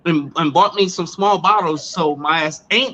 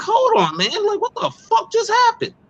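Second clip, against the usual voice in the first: high, much higher shocked outburst; shocked disbelief. Retold or imitated. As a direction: squeal it in disbelief. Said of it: "I was like".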